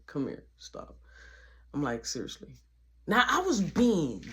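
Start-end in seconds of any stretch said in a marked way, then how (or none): 0.1-2.6 soft sincere comfort. Retold or imitated.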